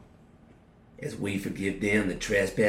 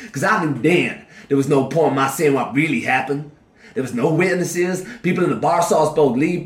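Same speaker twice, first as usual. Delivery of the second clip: furious shout; 6 semitones higher, 6 dB louder than usual. Acted. Prisoner rages at victim's father.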